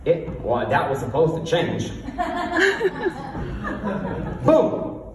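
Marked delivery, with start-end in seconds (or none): 2.0-4.4 airy giggle, high up; high breathy laugh. Real, not acted. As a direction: crack up at your own joke. Laughs at own joke.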